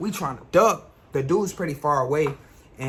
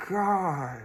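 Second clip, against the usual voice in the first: mock dread; 4 dB louder, much slower than usual. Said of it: "I'm like".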